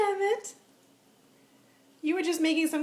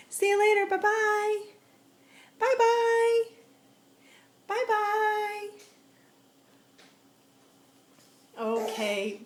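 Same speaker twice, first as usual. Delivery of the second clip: sing-song goodbye; 5 semitones higher, 4 dB louder, slower than usual. Real, not acted. Mom waving goodbye to baby.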